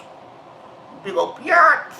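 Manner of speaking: very slow taunt leaping up high on BIATCH; high-pitched taunt. Acted. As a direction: stretch BIATCH out into a high squeal. Taunting insult, escalated repeat.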